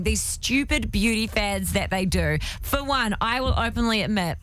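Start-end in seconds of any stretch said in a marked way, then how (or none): none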